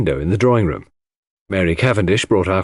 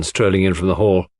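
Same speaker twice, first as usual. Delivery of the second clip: ordinary delivery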